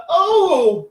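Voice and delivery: put-on high, loud drawn-out "oh" of an old dear; mock horrified. Little old lady hearing the band is Slayer, horrified old-dear voice. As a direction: imitate an old lady taken aback. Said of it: "looks a bit horrified".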